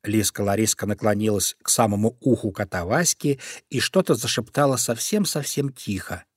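usual speaking voice

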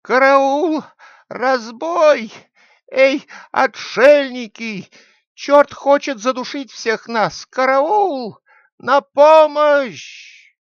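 loud, high-pitched alarm shouts; crying out for help. A narrator's reading. Thief grabbed by the devil, shouting to wake the hermits. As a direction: yell for rescue at full voice, very high. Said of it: "который закричал".

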